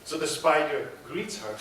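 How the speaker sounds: ordinary delivery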